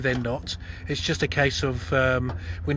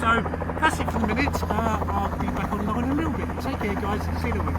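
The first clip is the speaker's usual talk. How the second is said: raised voice over engine noise; yelling over helicopter; 5 semitones higher, 4 dB louder than usual. Real, not acted. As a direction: call out above the noise. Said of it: "Excuse the helicopter behind".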